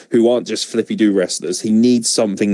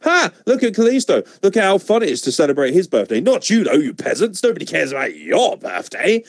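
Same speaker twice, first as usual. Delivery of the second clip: mock smug gloat; 6 semitones higher than usual. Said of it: "what I'm getting the vibe of is".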